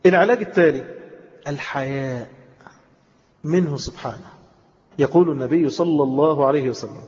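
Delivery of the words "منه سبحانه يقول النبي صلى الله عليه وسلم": usual speaking voice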